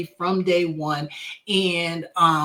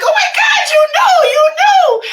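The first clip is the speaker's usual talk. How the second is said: very high, loud shriek, close to tears; overwhelmed with joy, almost crying; overjoyed shriek; 11 dB louder than usual. Retold or imitated. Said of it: "I'm like".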